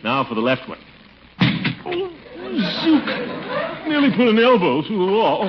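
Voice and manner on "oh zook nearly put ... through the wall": rueful laugh. Acted. Near accident while dressing.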